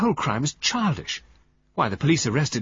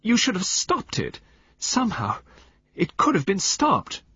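anguished reproach; 12 semitones higher than usual. A narrator's reading. Pamela reproaches Poirot.

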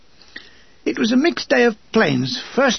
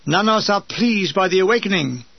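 plain reading voice

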